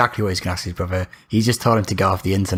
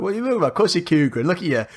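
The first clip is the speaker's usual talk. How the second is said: mock-incredulous retort, pitched up; incredulous comeback; 6 semitones higher than usual. Telling a friend how he called out cougaring, incredulous. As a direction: retell calling someone out in a higher, disbelieving voice.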